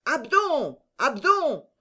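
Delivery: high-pitched call through a window. A narrator's reading. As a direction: holler the name to someone outside. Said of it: "vociféra Planchet par la fenêtre".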